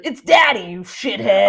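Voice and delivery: loud barked correction; angry snarl. Retold or imitated. Frank snapping at Dorothy, a loud mock bark. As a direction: bark it at her. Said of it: "he goes".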